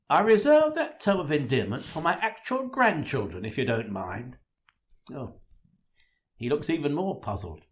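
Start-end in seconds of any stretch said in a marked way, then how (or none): 0.1-4.4 acted old man standing on his dignity; indignant correction. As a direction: correct the lad, affronted and emphatic. Old man rebukes being called Grandad.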